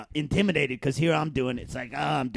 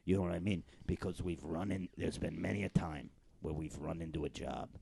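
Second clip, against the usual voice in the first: low, hushed mob talk; hushed mobster scheming; 9 semitones lower, 11 dB quieter than usual. Acted. Softer, lower gangster plotting to a partner. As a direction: keep it down, conspiring like gangsters.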